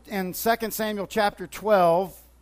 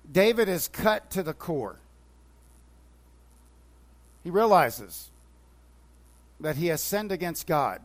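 usual speaking voice

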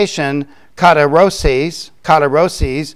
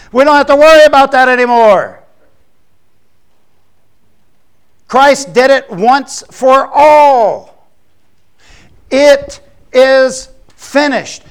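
much higher, louder triumphant proclamation; preaching at full force; 8 semitones higher, 7 dB louder than usual. Acted. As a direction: ring it out, slow on It is finished. Triumphant climax of sermon.

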